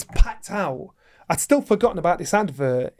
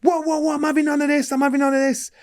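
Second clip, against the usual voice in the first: mock panic; 10 semitones higher than usual. Retelling the panicked partner again.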